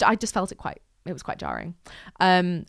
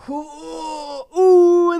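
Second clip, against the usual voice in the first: high, wavering crying ooh; 10 semitones higher, 9 dB louder than usual. Real, not acted. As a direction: let the sob break through the ooh. Overwhelmed recalling sad film character.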